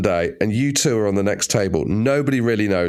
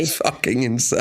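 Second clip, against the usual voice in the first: laughing; 2 semitones higher than usual. Real, not acted. Laughing about the crazy aunt.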